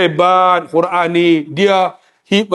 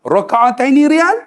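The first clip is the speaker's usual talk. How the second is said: boldly confident, rising at the end; mock-confident bargaining tone; 7 semitones higher than usual. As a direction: say it sure of yourself, asking with a lift. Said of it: "dengan confident tu".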